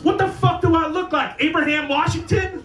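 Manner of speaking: high, loud snap at the stepdad; imitating direction guy. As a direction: bark it back like an offended street guy.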